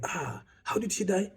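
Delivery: high-pitched mock disbelief, drawn out in places; shocked mourners. Condolence callers questioning the bereaved, in exaggerated high-pitched shock. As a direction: imitate astonished acquaintances wailing their surprise. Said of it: "people coming to you and they're like".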